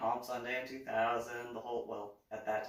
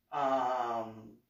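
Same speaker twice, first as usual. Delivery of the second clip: normal talking voice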